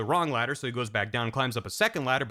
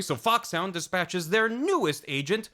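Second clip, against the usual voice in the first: ordinary talk, nothing marked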